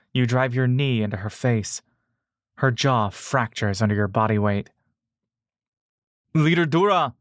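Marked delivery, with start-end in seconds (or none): 6.3-7.1 high, calling out across the fight; shouting a name. A narrator's reading. Ulur rushing in to help her leader, high urgent shout. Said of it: "you hear Ulur shout".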